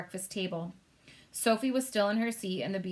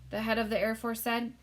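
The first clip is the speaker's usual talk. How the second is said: plain reading voice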